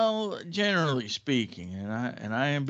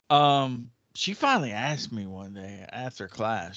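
normal talking voice

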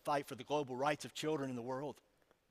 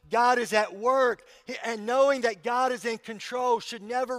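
preaching; 7 semitones higher, 9 dB louder than usual. Acted. Preaching at full force.

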